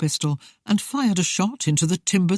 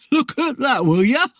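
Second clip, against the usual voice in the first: a hint of a jeering ruffian, pitched up; lightly voiced amused highwayman, higher than usual; laughing highwayman; 8 semitones higher, 4 dB louder than usual. A narrator's reading. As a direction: toss it out as a mildly mocking rogue. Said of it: "he laughed".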